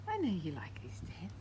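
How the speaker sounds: soft whisper to a pet. Real, not acted. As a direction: hush it gently to the pet.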